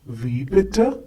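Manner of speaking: "Wie bitte?" rising at the end like a question; question intonation. Shown as a demonstration. Teacher models asking someone to repeat, rising at the end. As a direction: let "bitte" lift up as you ask. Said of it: "very important to use the correct intonation".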